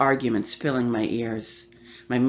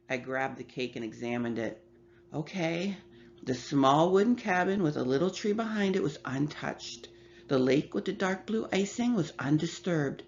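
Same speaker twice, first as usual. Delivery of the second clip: ordinary delivery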